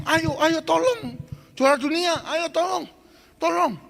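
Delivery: high, loud urging; urgent crowd. Retold or imitated. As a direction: plead with the champion to act now. Crowd urges champion to help. (desakan panik orang-orang di pinggir kolam)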